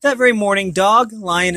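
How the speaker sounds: ordinary delivery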